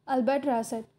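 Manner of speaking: ordinary delivery, nothing marked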